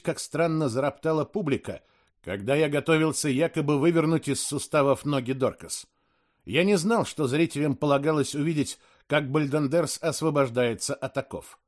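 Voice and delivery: plain reading voice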